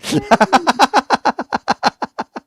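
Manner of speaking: long, high burst of laughter; loud laugh. Real, not acted. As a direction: crack up loudly at a silly mishearing. Host laughs at misheard pants.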